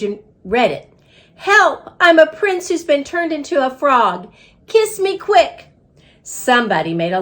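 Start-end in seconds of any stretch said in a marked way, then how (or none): 1.4-5.7 high pleading cry for help; squeaky frog plea. Acted. Note read to the class in a high frog-prince plea.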